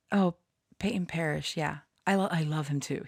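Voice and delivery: ordinary delivery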